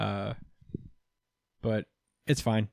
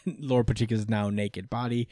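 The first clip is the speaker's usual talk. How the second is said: ordinary delivery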